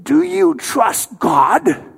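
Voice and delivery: loud, high, slowly drawn-out challenge; mean Soviet officer. Retold or imitated. Soviet officer staring down a smuggler, in a mean, loud, slow voice. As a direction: imitate a mean interrogator, stretching each word. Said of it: "in his mean tone of voice".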